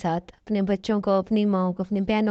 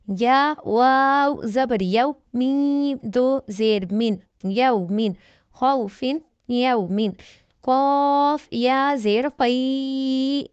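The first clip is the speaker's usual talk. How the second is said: slow, deliberate letter-by-letter recitation, each syllable set apart; slow tajweed spelling drill; 3 semitones higher, much slower than usual. Shown as a demonstration. Teacher drilling students in Quran reading, slow syllable recitation. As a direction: spell each letter and vowel slowly for beginners.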